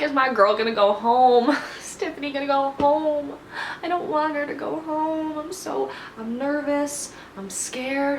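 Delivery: high, anxious fan voice; worried fan fretting over her favourite; nervous fretting. Real, not acted. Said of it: "Very, very nervous".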